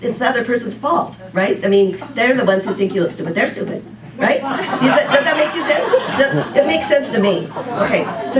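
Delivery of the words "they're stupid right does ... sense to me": laughing. Real, not acted. Amused at own joke.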